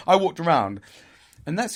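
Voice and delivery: usual speaking voice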